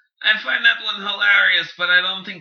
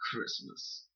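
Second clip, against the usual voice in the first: whispered; 8 semitones lower, 19 dB quieter than usual. Acted. Trails into whisper.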